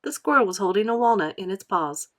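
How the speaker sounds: usual speaking voice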